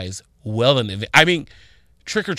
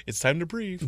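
soft, lighter gadget prompt; mock smartwatch voice; 4 semitones higher, 11 dB quieter than usual. Smartwatch telling heated Floyd, soft mock device voice. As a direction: imitate a calm watch alert. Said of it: "my watch, like".

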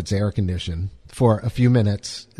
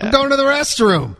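higher, put-upon answer to a guard; mock exasperated reply; 11 semitones higher than usual. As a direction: whine it like a hassled guest. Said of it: "where are you going?".

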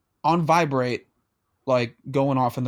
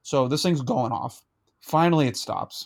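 normal talking voice

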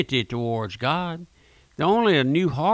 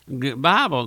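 ordinary talk

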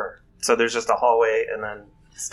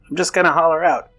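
hollering out; 7 semitones higher, 6 dB louder, faster than usual. Acted. Player announcing a shout.

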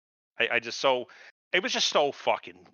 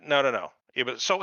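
ordinary delivery

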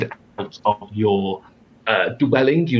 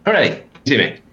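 relieved cheer; 3 semitones higher, faster than usual. Real, not acted. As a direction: cheer as the camera comes back on. Camera finally works again.

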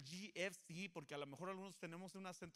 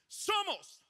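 much higher, much louder shout of Somos; battle-cry shout; 11 semitones higher, 11 dB louder than usual. Shown as a demonstration. Pastor rallies the congregation like soldiers, shouting. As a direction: yell it like charging into war. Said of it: "lo vas a gritar como que si estamos ... a punto de entrar en la guerra".

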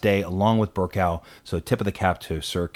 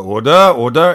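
loud, higher call to order; raised-voice parliamentary impersonation; Bercow; 4 semitones higher, 11 dB louder than usual. Retold or imitated. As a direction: imitate a Speaker calling the House to order. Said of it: "Bercow would go".

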